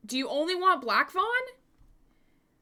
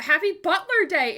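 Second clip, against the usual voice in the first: excited; 7 semitones higher, 8 dB louder than usual. Real, not acted. Cheering birthday.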